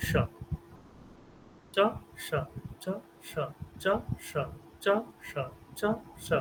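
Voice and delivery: short alternating চ and স, স hushed as sh; palatal sh. Shown as a demonstration. Teacher drilling students on tongue placement, palatal sh. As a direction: say স as sh, where চ is made. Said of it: "ঠিক ওই জায়গাটা স উচ্চারণ করুন".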